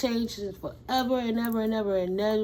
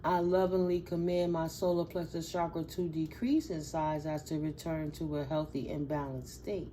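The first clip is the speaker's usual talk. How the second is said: calm, measured affirmation; affirmation read; 3 semitones lower, 5 dB quieter than usual. Shown as a demonstration. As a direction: recite it like a mantra. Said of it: "how you would utilize this affirmation mantra".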